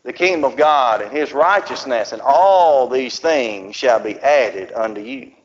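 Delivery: slow, solemn reading of scripture; measured scripture reading. Acted. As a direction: read it out with weight. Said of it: "The Bible there says".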